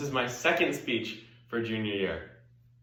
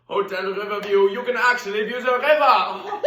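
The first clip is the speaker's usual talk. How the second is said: loud, high-pitched showy cabaret host; Hedwig, brash stage diva; 11 semitones higher, 8 dB louder than usual. Acted. Diva thanking her grand-opening audience, loud and effusive. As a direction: belt out the welcome to the crowd.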